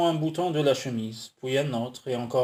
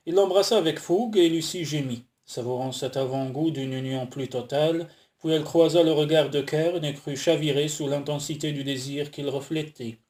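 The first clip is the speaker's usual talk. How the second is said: plain reading voice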